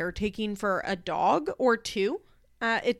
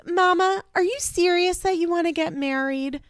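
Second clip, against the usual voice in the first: put-on excited child; 9 semitones higher, 4 dB louder than usual. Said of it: "she goes".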